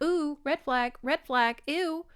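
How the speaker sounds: lightly hammed-up recoil; mock alarm. Reader flagging a bad sign, mock alarm. Acts out her reaction while reading.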